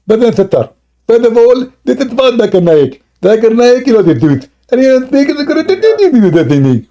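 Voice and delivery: very high, squealing mock-preacher impression; Zakir Naik. Shown as a demonstration. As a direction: exaggerate the preacher in a piercingly high voice. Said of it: "Zakir Naik speak like this".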